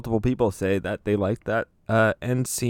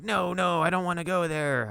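mock protest; 9 semitones higher, 3 dB quieter than usual. Retelling a film character refusing in the car, mock protest. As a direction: imitate someone balking in a higher, drawn-up voice. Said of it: "he's like".

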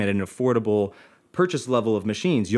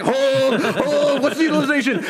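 high, drawn-out holding order; mock commander restraining the crew; tense, hammed-up battle call; ship's captain; 12 semitones higher, 3 dB louder than usual. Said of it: "felt like we were on a ship".

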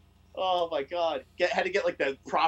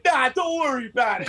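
higher, shouted mock brush-off; dismissive intruder; 8 semitones higher, 4 dB louder than usual. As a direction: wave them off like a standoffish guest.

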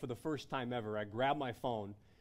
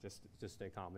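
hushed, lower voice soothing a caller; much softer, low reassurance; calming dispatcher; 4 semitones lower, 11 dB quieter than usual. Retold or imitated. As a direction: imitate an operator softly talking someone down. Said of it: "and they're like".

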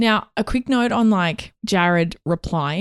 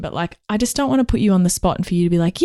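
ordinary delivery

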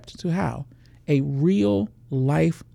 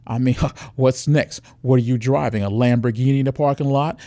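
loud mocking goad; sarcastic taunting; 5 dB louder than usual. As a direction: needle her pride till she answers. Predator mocks woman's pride.

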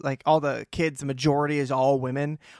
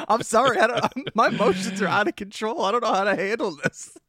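higher, giggling, flustered apology; laughing apology; 6 semitones higher than usual. Retold or imitated. Apologizing to his aunt at the wake, laughing. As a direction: laugh through a helpless sorry. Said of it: "I was like".

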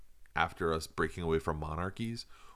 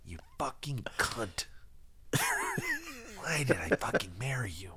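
hushed whisper of a bitter husband; whispered Macy impression; 5 semitones higher, 5 dB quieter than usual. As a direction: whisper the insults like a resentful Macy. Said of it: "I'm making him whisper".

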